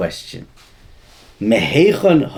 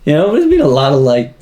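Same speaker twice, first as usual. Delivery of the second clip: incredulous retort; 4 semitones higher, 4 dB louder than usual. Retold or imitated. Student's retort to rebbe, retold.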